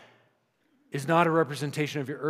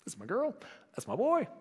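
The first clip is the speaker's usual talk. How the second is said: much higher, softer, sing-song doting; God gazing from heaven's balcony, softly doting; fond delight; 11 semitones higher, 5 dB quieter than usual. Retold or imitated. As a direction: coo it like a proud parent leaning over. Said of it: "he's like".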